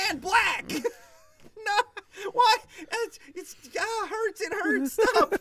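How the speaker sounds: can barely get it out for laughing. Real, not acted. Friends cracking up at their own joke, high laughing.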